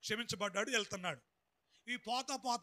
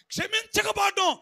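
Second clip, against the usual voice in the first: emphatic repeat; 9 semitones higher, 11 dB louder than usual. Shown as a demonstration. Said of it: "ఏంటమ్మా".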